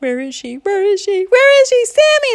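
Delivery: hammed-up mounting hysteria; super freaked out. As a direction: panic building from high to very high and loud. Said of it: "started to get super freaked out".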